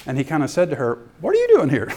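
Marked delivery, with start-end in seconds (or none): 1.2-1.9 high, louder mock challenge; exaggerated surprise. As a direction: blurt it out in jokey astonishment. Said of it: "he didn't say it that way".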